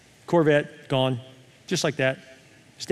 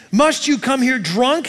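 high, slow accusing demand; hammed-up indignant question, drawn out; 9 semitones higher, 4 dB louder, slower than usual. Eli confronts Hannah as drunk, high indignant demand. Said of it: "he demanded".

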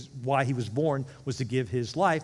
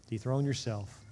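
usual speaking voice

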